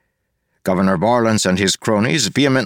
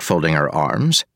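ordinary delivery

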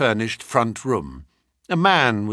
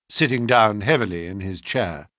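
usual speaking voice

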